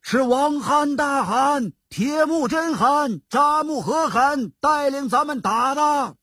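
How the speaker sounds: high, sustained soldiers' shout without extra volume; crowd cry. A narrator's reading. Soldiers answering their khan, high crowd cry. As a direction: call out like a whole army answering. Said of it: "众兵将叫了起来".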